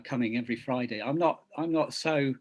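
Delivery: ordinary talk, nothing marked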